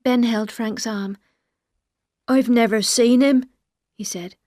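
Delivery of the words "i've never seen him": louder put-on voice of a scared simple man; simple, scared denial in character; Ben, frightened. Ben clings to Frank and denies it, frightened.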